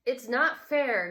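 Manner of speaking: put-on loud, slow child's yell. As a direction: yell it out like an upset boy. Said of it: "he yelled".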